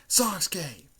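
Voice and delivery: high, loud cry of a name; acted calling out. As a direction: call after someone walking away. Friends call after departing Sasuke.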